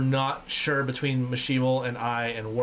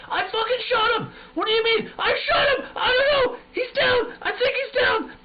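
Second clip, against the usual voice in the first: much higher, louder near-crying shout; panicked shouting; 5 dB louder than usual. Retold or imitated. Shouting to teammate that he shot the enemy, hysterical yell. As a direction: scream it in shaky hysteria.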